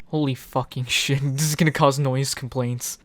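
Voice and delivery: normal talking voice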